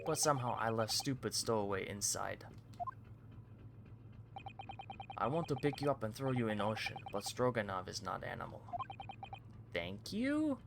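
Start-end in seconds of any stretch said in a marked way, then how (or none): 0.1-8.6 Russian sailor boasting. Acted. Guard brags about the VIP cabins, lightly Russian-accented. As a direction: show it off like a proud Russian guard.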